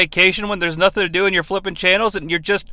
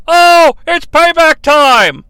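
high, loud, slow commentator yell; dramatic announcer holler; Gorilla Monsoon yell; 6 semitones higher, 6 dB louder, slower than usual. Retold or imitated. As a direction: stretch the call out at ringside. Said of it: "Gorilla Monsoon yells".

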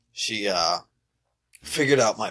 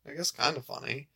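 unimpressed; 6 semitones higher, 8 dB quieter than usual. Retold or imitated. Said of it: "But everyone else is like".

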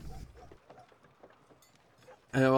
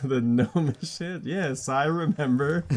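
slow amused drawl; 4 semitones higher, slower than usual. Real, not acted.

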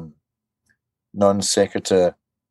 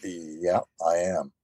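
softer, lower deadpan answer; flat deadpan reply; 5 semitones lower, 8 dB quieter, slower than usual. Retold or imitated. Assassin answering the fashion designer, flat and quiet. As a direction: mutter it, stiff and unsure. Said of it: "And he's going".

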